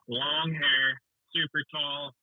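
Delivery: usual speaking voice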